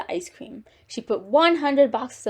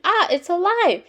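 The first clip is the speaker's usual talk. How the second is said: high-pitched shriek of alarm; frightened scream; 8 semitones higher, 4 dB louder than usual. A narrator's reading. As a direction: scream it as a startled lady. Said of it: "The lady screamed".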